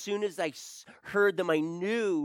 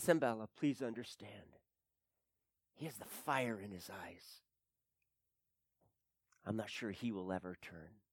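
soft plea; 9 semitones lower, 12 dB quieter than usual. Retold or imitated. Father quotes his own gentle plea.

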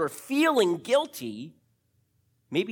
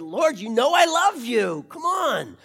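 frustrated plea; 5 semitones higher, 8 dB louder than usual. Retold or imitated. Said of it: "he gets frustrated".

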